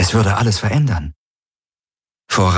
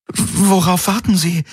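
lightly voiced woman, high and bewildered; confused Kirai; 11 semitones higher than usual. A narrator's reading. Kirai to Galen, baffled by the halted attack, lightly voiced confusion. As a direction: Kirai asking, lost and puzzled. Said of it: "Kirai klang hoffnungslos verwirrt".

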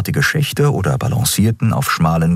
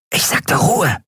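high-pitched, exasperated snort; snorted rebuke; 11 semitones higher, slower than usual. A narrator's reading. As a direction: snort the order for silence. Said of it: "schnaubte Grau". (gereizt schnauben)

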